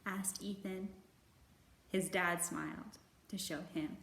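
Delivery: put-on gentle, measured read-aloud for children; storybook narration. A narrator's reading. Librarian reading a picture book to kids, gentle narration.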